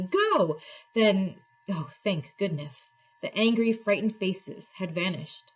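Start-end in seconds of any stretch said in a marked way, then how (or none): 0.0-0.6 raised, higher-pitched command; understated narrated shout; mild shout. A narrator's reading. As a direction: call it out urgently, without full force. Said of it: "shouting to Polly".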